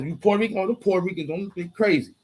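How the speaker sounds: hushed, offhand mock comment; casual remark. Shown as a demonstration. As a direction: say it lightly, as a harmless aside. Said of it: "We just say who they are".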